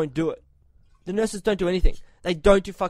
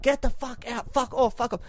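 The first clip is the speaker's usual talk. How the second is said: volatile yelling; 5 semitones higher, 3 dB quieter than usual. Retold or imitated. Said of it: "volatile animal".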